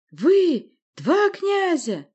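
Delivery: high, loud, drawn-out call; relentless screech. A narrator's reading. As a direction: screech at the two princes. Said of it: "не унималась Власта".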